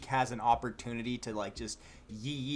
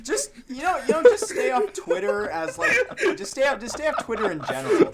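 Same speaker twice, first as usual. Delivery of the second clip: laughing; 11 semitones higher, 9 dB louder than usual. Real, not acted. Laughing while scolding.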